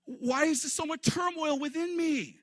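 high, pained cry to his own soul; anguished lament. Acted. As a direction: plead with your soul in distress. Quoting the psalmist's anguished lament.

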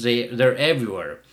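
usual speaking voice

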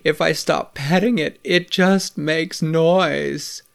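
a man who hasn't slept for days; exhausted. A narrator's reading. Sleepless neighbor complaining to River about the cat, exhausted. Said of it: "I'm so tired".

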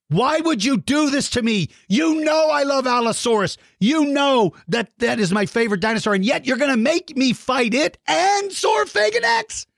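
high whiny protest; mock whining. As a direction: plead like a wounded fan. Mock pleading with kid.

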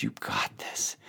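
unvoiced hushed whisper of "You got this". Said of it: "He's whispering in your ears".

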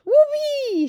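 very high, loud, drawn-out whoop; shouted whoop. A narrator's reading. Giant celebrating his whiz-popper, shouting. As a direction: let rip with a long whoop. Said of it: "shouted the B F G".

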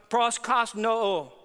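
proskosno spelled out slowly, syllable by syllable; Greek word said carefully. Shown as a demonstration. Preacher spelling out the Greek word for worship, slow and careful. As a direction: lay out proskosno deliberately so they hear each sound. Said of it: "The Greek word u-, used is".

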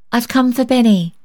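put-on high-pitched little girl's voice; child's announcement. A narrator's reading. Child character at the door.

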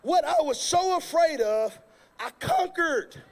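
normal talking voice

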